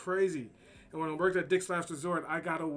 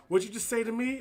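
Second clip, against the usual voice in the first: imitating an angry customer, higher and louder; confrontational quote; 6 semitones higher, 5 dB louder than usual. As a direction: throw it back like a tough guy squaring up. Customers get in his face.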